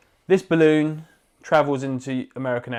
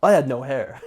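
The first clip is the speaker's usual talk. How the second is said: mock advert testimonial; 4 dB louder than usual. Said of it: "A really American style advert".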